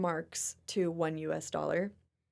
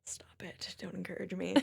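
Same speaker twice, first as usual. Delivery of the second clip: hushed whisper, much softer; 11 dB quieter than usual. Real, not acted. To cohost after praise, playful whisper. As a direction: whisper it playfully, barely voiced. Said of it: "unvoiced whisper".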